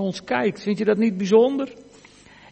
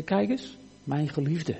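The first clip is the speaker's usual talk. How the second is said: softly spoken, lower confidential aside; zacht vertrouwelijk; 4 semitones lower, 8 dB quieter than usual. Acted. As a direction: nudge someone and point them out quietly. God stoot zijn zoon aan, wijst. (zacht en vertrouwelijk aanwijzen)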